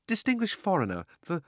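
high, nervous stammer. A narrator's reading. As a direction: stutter it out, frightened. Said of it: "he stammered".

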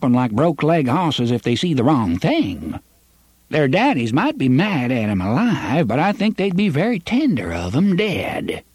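put-on high, quavering old man warning; quavery old man. A narrator's reading. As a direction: caution them in a shaky old voice. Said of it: "his quavery voice".

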